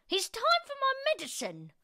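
put-on high, fast grumpy old granny; awful granny voice. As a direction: demand it like a cranky grandmother. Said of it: "came the awful voice from the next room".

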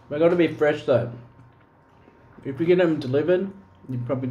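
talking between bites; chewing while talking. Real, not acted. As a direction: mouth partly full. Seen 2: Ate a fry at -1.0; jaw moves mid-speech early on.

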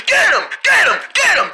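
high, urgent repeated shouts; frantic yelling. Acted. Shouting while the turtle freaks out, frantic yelling. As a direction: fire off each cry in alarm. Patient flailing at the ice.

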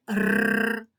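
a long trilled р alone; sustained р trill with no vowel; isolated rolled Р. Shown as a demonstration. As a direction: roll the р and hold it. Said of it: "Наступний звук".

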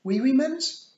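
put-on very high, loud toddler question; light up with hope like a small child; excited, piping toddler; brightened-up little boy. A narrator's reading. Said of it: "Wentworth brightened up".

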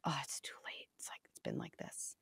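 hushed, helpless whisper; whispered parent excuse. Retold or imitated. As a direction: murmur the excuse like a defeated parent.

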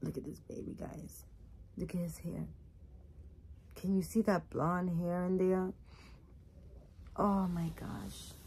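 very soft, near-whispered admiring aside; softly marvel, almost whispering; hushed awe. Real, not acted. Showing the baby doll up close.

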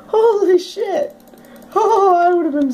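stunned relief after the scare; stunned exclaim. Real, not acted. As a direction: still shaken.

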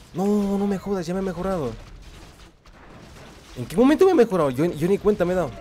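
suddenly amazed, voice jumping up; surprised. Real, not acted. Realizes unit got upgraded unexpectedly.

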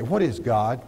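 ordinary delivery

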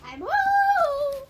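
high, loud call announcing arrival; high cartoon voice calling home. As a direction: shout it to the whole house.

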